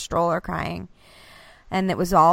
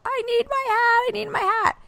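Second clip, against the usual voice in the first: very high mock kid wail; tantruming child. Son demanding his hat from mom, high-pitched mock tantrum. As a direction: imitate a small boy's whiny meltdown.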